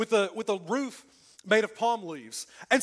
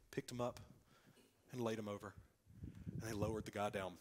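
gentle lowering; 11 semitones lower, 14 dB quieter than usual. Shown as a demonstration. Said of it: "we think they were like".

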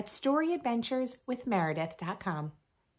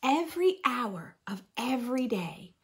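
whisper; 3 semitones higher than usual. Acted. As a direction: whisper it like a gentle secret. Whispered line in book.

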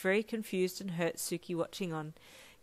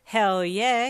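loud, eager and excited; enthusiastic; 3 semitones higher, 10 dB louder than usual. A narrator's reading. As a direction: burst out with enthusiasm. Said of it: "he replied enthusiastically".

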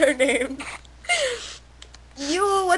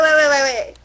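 ordinary delivery

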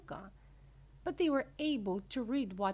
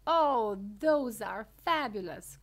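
thrilled cry; 2 semitones higher, 9 dB louder than usual. A narrator's reading. Wonka at the fizzy drinks door, crying out thrilled. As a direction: burst out loudly with delight. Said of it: "cried Mister Wonka".

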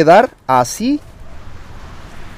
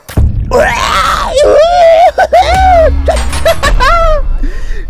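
shrieking laugh. Real, not acted. Laughing after fight.

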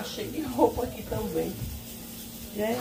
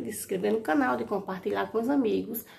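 ordinary talk

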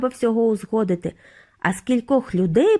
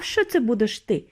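ordinary delivery, nothing marked

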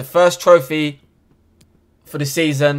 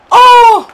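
very high, very loud shriek; excited yell; 15 dB louder than usual. Real, not acted. Gamer reacting to a near goal, high shriek. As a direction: shriek it out in pure shock. Huge moment in match.

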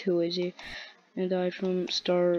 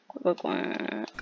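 duck quack; 10 semitones lower, 5 dB quieter, slower than usual. Pretend. Quacking as the penguin.

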